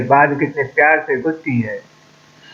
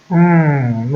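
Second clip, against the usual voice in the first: ordinary delivery, nothing marked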